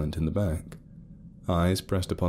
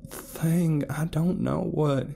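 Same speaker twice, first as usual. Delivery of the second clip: higher, uncertain explaining; Carol explaining; 10 semitones higher than usual. A narrator's reading. Carol telling Felton about the shared dream, unsure. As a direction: search for the words. Carol continues her account.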